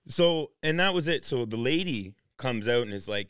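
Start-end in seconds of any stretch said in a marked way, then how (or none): none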